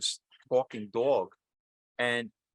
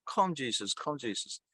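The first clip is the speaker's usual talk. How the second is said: usual speaking voice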